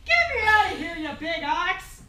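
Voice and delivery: frantic yell. Acted. Wife trapped in the oven screams at the giant. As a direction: shout at him to free you.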